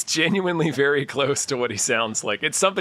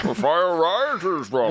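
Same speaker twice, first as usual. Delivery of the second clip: Bane impression; 2 semitones higher, slower than usual. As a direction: stretch it out as a mock masked-villain interrogation. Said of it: "he did the Bane voice for Chris Hansen".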